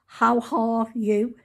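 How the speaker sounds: slow, lightly posh sing-song "how are you"; mock-genteel lilt; Missus Bouquet voice. Lightly voiced Hyacinth Bucket impression for her viewers. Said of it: "Missus Bouquet voice".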